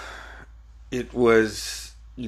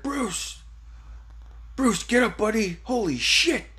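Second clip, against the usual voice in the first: high, urgent retold call; pitched-up alarm, no real shout; 11 semitones higher, 3 dB quieter than usual. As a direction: imitate someone urging the injured to get up. Retold man calling out to hurt boy.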